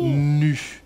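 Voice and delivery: "nu" sounded out with a long n; loud-ish, lengthened "nu" on its own; isolated syllable. Shown as a demonstration. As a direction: stretch the n into "nu". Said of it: "Et puis après".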